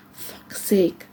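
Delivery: exasperated, voiceless whisper; whispered curse. A narrator's reading. Man refused information by his friend, outraged whisper. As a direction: hiss it under your breath in outrage.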